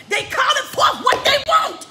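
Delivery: preaching at full force. Real, not acted.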